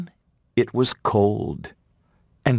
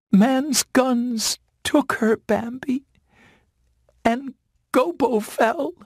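tearful news. A narrator's reading. Feline telling Bambi of the deaths, tearful high voice. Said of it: "She started to cry".

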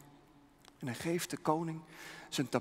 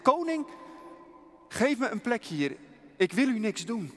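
higher and louder, calling out; bold plea; 6 semitones higher, 5 dB louder than usual. Acted. Missionary pleading with the armed king, higher and louder.

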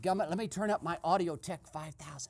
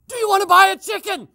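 very loud, high, fast bellow; shouting to the deaf; 11 semitones higher, 18 dB louder, faster than usual. Acted. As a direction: yell it as if he's deaf. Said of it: "how to sell a deaf man".

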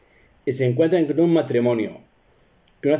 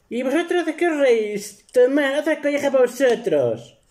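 much higher scolding wife voice; 13 semitones higher than usual. Retold or imitated. (esposa regañona en voz agudísima)

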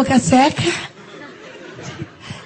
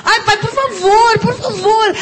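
whiny crying plea; 5 semitones higher, slower than usual. Retold or imitated. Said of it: "Aí eu faço pro meu pai".

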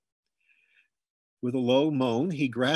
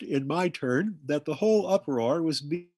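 plain reading voice

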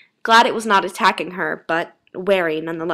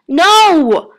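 high, very loud cry of protest; yelled; 10 semitones higher, 11 dB louder than usual. A narrator's reading. Man in tree handcuffs, high loud yell. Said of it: "the tall, slender man yelled".

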